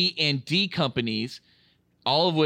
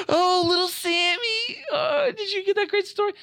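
cooing grandparent. Retold or imitated. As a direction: gush over the little boy.

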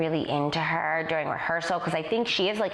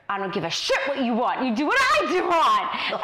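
harsh director; 6 semitones higher, 4 dB louder than usual. Retold or imitated. Director snaps at dancer in rehearsal.

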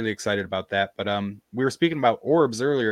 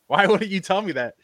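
high-pitched, exasperated questioning; mock-indignant query, pitched well up; retold incredulity; 8 semitones higher, 4 dB louder than usual. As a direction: act baffled at being left out. Retold confronting dad over withheld news.

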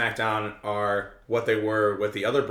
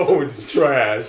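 slow, louder mock kid voice, laughing; deep kid voice; 2 semitones higher, 7 dB louder, slower than usual. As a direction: echo the kid's taunt while laughing. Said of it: "That was actually a very deep voice for a little child".

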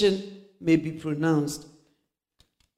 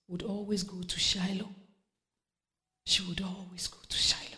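whispered, much softer sermon lines; hushed preaching; 10 dB quieter than usual. Acted. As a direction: draw them in with a whisper.

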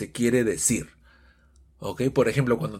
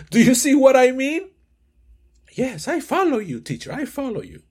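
high, louder student voice; 9 semitones higher, 4 dB louder than usual. Acted. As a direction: keep the pupil's voice up high. Acted student dialogue.

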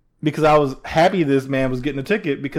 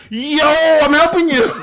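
imitated yo call-out; 10 semitones higher, 5 dB louder than usual. Host acting out the delivery man's message, imitated holler. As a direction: mimic someone calling out high and loud. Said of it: "He yoed it and was like".

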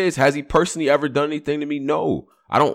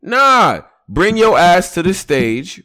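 louder, slow and emphatic; loud demand; 5 semitones higher, 5 dB louder, slower than usual. Retold or imitated. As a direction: call him out forcefully. Demanding Avo apologize publicly.

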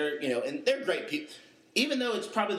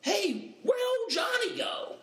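calling out. Retold or imitated. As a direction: holler for the missing student. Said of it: "the delivery nurse is like".

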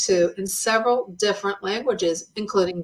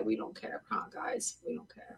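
hushed whisper aside; 18 dB quieter than usual. Acted. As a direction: whisper it like a secret to the audience. Hushed joke to viewers.

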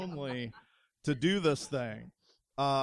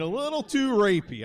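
emphatic; 7 semitones higher, 8 dB louder than usual. Real, not acted. Emphatic complaint to crowd.

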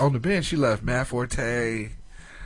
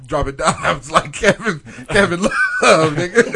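laughing; 3 semitones higher, 9 dB louder, slower than usual. Real, not acted. Laughing hype about his team.

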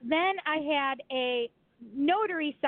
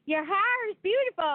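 higher-pitched Southern friend impression; imitated Southern accent; 6 semitones higher than usual. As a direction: mimic a drawling Southern compliment. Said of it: "she'd say".

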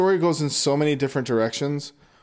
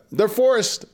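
usual speaking voice

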